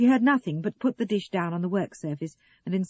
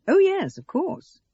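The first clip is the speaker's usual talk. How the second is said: high, loud mock agreement; disbelieving; 12 semitones higher, 8 dB louder, slower than usual. A narrator's reading. Russell answering Sarah's excuse, disbelieving. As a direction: agree as if you don't believe it. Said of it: "He sounded disbelieving".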